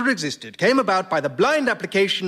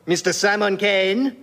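put-on outrageous French accent. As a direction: introduce him in deliberate, hammy French. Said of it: "in outrageous French accent".

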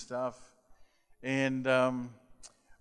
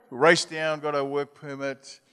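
usual speaking voice

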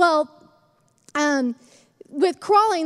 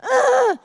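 baby's mock frustrated grunt; 9 semitones higher, 5 dB louder than usual. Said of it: "And he'd be like".

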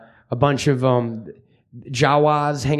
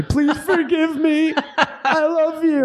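very high, slow, laughing mock sob; mock hysterical weeping; 11 semitones higher, slower than usual. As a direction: ham up a dad's tearful plea. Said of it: "weeping hysterically".

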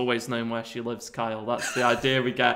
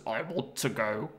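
lightly voiced Mister Bean; 7 dB quieter, slower than usual. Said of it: "And he just goes".

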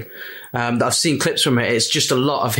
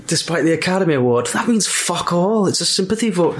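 higher-pitched acted film line; Trainspotting quote; 5 semitones higher than usual. Quoting Trainspotting to co-hosts, high acted delivery. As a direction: quote it with relish, stretching the swearing. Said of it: "Don't they say in Trainspotting".

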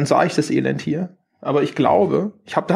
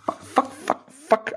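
whispered cursing; 7 semitones higher, 5 dB quieter, slower than usual. Real, not acted. Coffee spilled on laptop.

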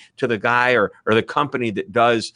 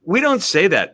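usual speaking voice

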